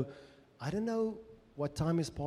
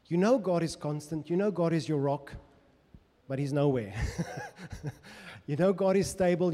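ordinary talk

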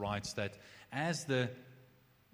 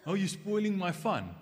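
higher, louder mock child complaint; hammed-up sulky kid protest; whiny child; 7 semitones higher, 5 dB louder than usual. Child whining to mother about spoiled fun, mock sulky.

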